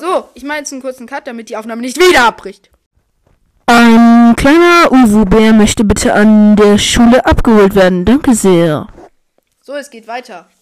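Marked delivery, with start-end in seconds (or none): 3.7-8.9 loud, slow announcer voice; cute anime streamer. Pretend. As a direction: call the little bear like an announcer. Announcing the uwu bear.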